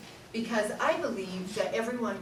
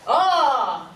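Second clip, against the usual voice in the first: louder open ah on a breath; 3 semitones higher, 7 dB louder than usual. Shown as a demonstration. Teacher modelling the sound for the class, loud ah. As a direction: breathe in and sing out ah. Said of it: "I want you to go".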